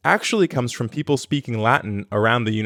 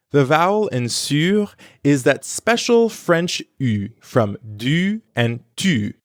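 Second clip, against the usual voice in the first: ordinary talk, nothing marked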